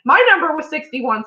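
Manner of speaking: ordinary delivery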